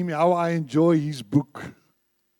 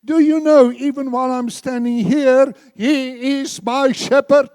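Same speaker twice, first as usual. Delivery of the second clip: fervent shout; 7 semitones higher, 11 dB louder than usual. Real, not acted. Repeats the question, now shouted.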